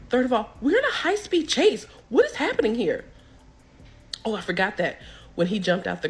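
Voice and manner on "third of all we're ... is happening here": high, exasperated mock panic; retold freak-out, pitched up high; panicked outburst. Terrified passenger listing why they are going to jail, panicked.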